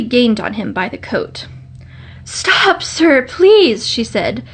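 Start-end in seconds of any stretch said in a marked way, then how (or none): 2.2-3.9 a lightly acted breathless plea, higher; out of breath. A narrator's reading. Girl catching the short man after running, mildly breathless plea. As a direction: call after him as if winded from running. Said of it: "she was so out of breath".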